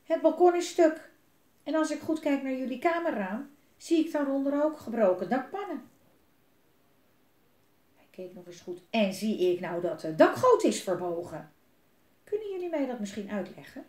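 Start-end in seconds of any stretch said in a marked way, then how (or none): 0.0-5.9 loud, higher angry scolding; father angrily listing the damage. Acted. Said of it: "wees boos". 8.9-13.8 loud angry demand; dad scolding his children loudly. Acted. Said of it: "wees boos".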